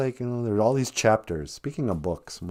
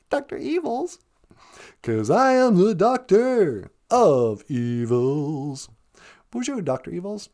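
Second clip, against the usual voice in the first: hammed-up villain; 6 semitones higher than usual. Hammy villain doctor persona.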